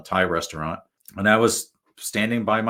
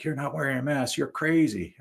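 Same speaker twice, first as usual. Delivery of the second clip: mock yell; 8 semitones higher than usual. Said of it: "He's screaming".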